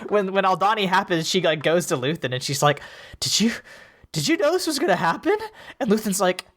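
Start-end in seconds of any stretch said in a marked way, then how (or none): 3.2-5.4 high, incredulous mock-politician voice; mock Mon Mothma. Mon Mothma confronting Luthen, mock high disbelief. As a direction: play it wide-eyed and high.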